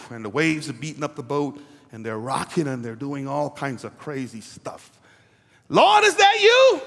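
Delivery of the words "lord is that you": high, loud shout across the water; acted Peter shouting. Peter shouting to Jesus across the waves. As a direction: call out to Jesus from the boat.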